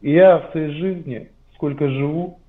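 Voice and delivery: usual speaking voice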